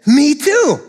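gleeful me too; excited me too. Acted. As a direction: brighten with glee at the match. Joke, excited agreement.

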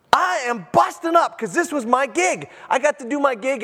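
high-pitched, bursting enthusiasm; acted elation. John the Baptist glad to do his job, acted elation.

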